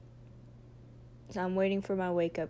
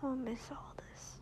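soft tearful whisper; 4 semitones higher, 6 dB quieter than usual. Real, not acted. Tearful goodbye to partner.

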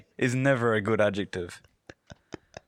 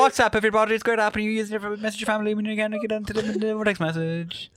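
rushed YouTuber intro; 9 semitones higher than usual. Shown as a demonstration. Cohost showing a super-fast YouTuber intro, higher pitch. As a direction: gabble it as one word. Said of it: "intro it as quickly as possible".

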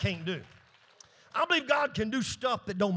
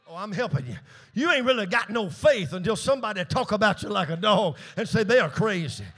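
normal talking voice

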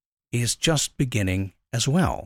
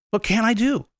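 higher, lifted question on "do"; eager ask; 8 semitones higher, slower than usual. Shown as a demonstration. Speaker restating the listener's question, higher and eager. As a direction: ask it again, brighter and higher. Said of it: "ask the question".

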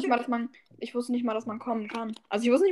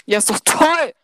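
ordinary delivery

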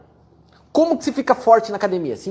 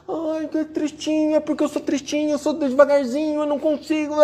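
mock a sad sack whining; whiny mock; 5 semitones higher than usual. Mocking a self-pitying follower. (voz chorosa debochada)

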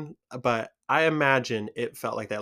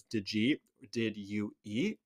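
Michigan did-ya; 3 semitones lower, 7 dB quieter, slower than usual. Shown as a demonstration. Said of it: "Michiganders love saying the did yas".

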